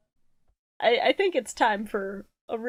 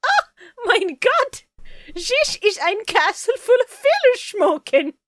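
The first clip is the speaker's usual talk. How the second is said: higher, slow mock German accent; 10 semitones higher, 3 dB louder, slower than usual. Meme recreation of a German boy, hammed-up German accent. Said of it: "I do that accent".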